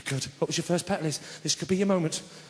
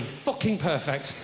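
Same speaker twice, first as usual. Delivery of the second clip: ordinary talk, nothing marked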